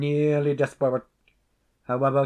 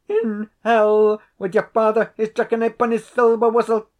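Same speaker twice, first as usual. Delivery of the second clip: much higher and louder outburst; defiant shout; 9 semitones higher, 8 dB louder than usual. A narrator's reading. Desperate tenant shouts back at the laird, loud and high. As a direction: hurl it at him in desperation. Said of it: "driven to extremity".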